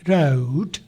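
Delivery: राउट low, with an "aa-o" glide; ow glide demo. Shown as a demonstration. Teacher showing the आओ sound in राउट, low. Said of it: "आ की आवाज़, आओ की".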